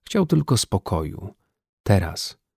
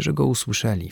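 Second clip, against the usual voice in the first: plain reading voice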